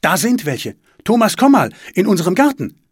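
high, loud alarmed call. A narrator's reading. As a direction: shout for help from another room. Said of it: "hören sie sie rufen". (aufgeregt rufend)

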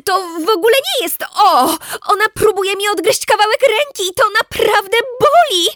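high, loud, pained complaint; high indignant outburst; upset protest. Acted. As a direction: insist it really hurts. Protesting being bitten.

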